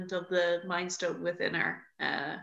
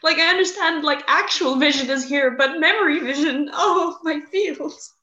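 higher, louder emotional outburst; overwhelmed; 6 semitones higher, 7 dB louder than usual. Real, not acted. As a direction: gush about it. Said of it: "And I'm like".

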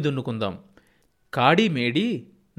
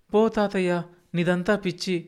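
put-on higher boyish dismissive tease; child teasing; 7 semitones higher than usual. A narrator's reading. Grandson teasing grandfather he is crazy, high playful voice. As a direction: brush him off like a cheeky kid.

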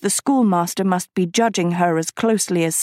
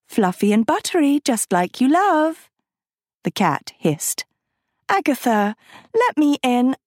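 higher, honeyed coaxing; sweet coaxing girl; 6 semitones higher than usual. A narrator's reading. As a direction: sweeten every word to get inside. Sophie coaxing at the door.

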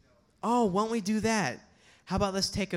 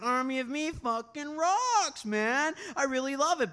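gushing fan; 7 semitones higher than usual. Retold or imitated. Cop gushing over song.

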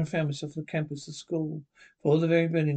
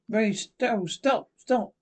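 high, louder cries of stop; frantic plea; 7 semitones higher, 3 dB louder than usual. Acted. Mary crying out at Darrell to stop, frantic. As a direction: cry out to make him stop. Wife begging husband to stop.

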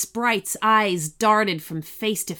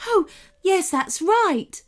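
brightening up; 11 semitones higher, 4 dB louder than usual. A narrator's reading. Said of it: "brightening up".

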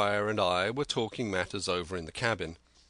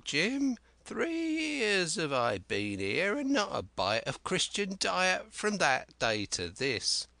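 high, slow, cracked voice of a wild marooned sailor; an eager half-mad castaway, higher and drawn out; Ben Gunn; 7 semitones higher, slower than usual. Acted. Marooned Ben Gunn eagerly pleading with young Jim, high and slow.

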